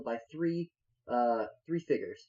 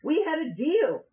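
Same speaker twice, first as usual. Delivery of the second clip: high, indignant character voice; protesting captive; 13 semitones higher, 4 dB louder than usual. Acted. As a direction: object sharply with a blade at the neck. Hezekiah protests at swordpoint.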